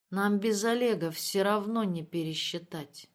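ordinary delivery, nothing marked

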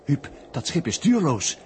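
loud, urgent play-by-play; excited report. Acted. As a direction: shout it over the noise like live commentary. Opgewonden verslag aan Huub. (de verteller roept opgewonden naar Huub wat hij ziet)

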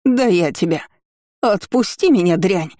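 put-on a touch of a furious old woman snapping; snarling bark. Aunt in pain barking at her niece, a mild mock snarl. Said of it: "рявкнула женщина".

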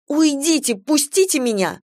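put-on high, panicky girl's plea; alarmed protest. A narrator's reading. Girl fending off a pursuer. (испуганно, высоким голосом)

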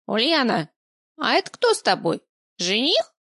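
put-on high, prying old woman voice; nosy old woman. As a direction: call down like a nosy granny.

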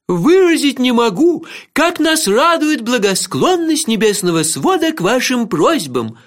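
high, slow ceremonial yelling; loud, formal proclamation at high pitch; eardrum-splitting shout. A narrator's reading. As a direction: holler courtly thanks with every word stretched. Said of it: "Сэр Кофа кричал так".

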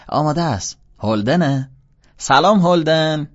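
high, loud calling voice; shouting between rooms. A narrator's reading. Wife calls from the kitchen to greet the guest, shouting. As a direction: call back loudly, stretching the greeting. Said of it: "باید مدام داد بزنی".

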